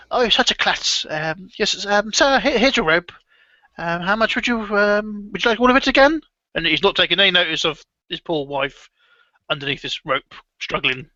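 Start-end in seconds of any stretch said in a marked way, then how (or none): 0.1-6.2 put-on high, hesitant old man's voice; eager to please the customer; fussy old shopkeeper.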